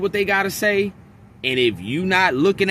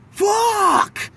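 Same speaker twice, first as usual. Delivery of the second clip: much higher, shouted fuck; showing how he screams out his frustration at night; letting out a scream. Shown as a demonstration. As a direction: let it rip into a sudden yell. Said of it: "I just gotta let that".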